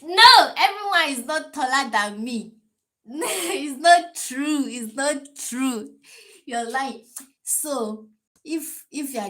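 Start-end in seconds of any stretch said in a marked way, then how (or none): 0.0-7.0 high, loud laughing denial; shrieking protest against her own words, laughing. Real, not acted. Laughing at her own height rule, she cries out high and loud in protest.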